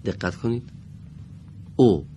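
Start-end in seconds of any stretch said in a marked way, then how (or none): 1.8-2.1 "او" as a short Arabic u; "او" as one short, separate syllable; Arabic short damma. Shown as a demonstration. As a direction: say "او" briefly with Arabic pronunciation. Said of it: "دقیقاً به لهجهٔ عربی".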